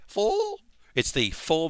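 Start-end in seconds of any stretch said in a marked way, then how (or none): 0.1-0.6 cracking voice. Real, not acted. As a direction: pitch jumps and breaks on full. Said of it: "my voice cracked there".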